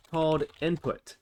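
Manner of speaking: normal talking voice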